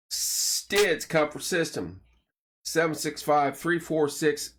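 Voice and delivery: upbeat sponsor plug, punchy and bright; radio ad read. Acted. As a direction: sell it like a local radio spot. Reads sponsor plugs.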